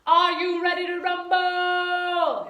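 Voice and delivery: loud, high, drawn-out announcer call; mock fight-night hype; ring announcer. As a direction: bellow it to the arena, stretching the last word. Mimics ring announcer catchphrase.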